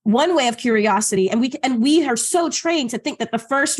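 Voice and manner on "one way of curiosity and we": usual speaking voice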